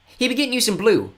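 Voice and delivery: put-on high, rough-spoken fen boy; scruffy young boy. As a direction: tell it like a grubby little kid. Tyke voiced.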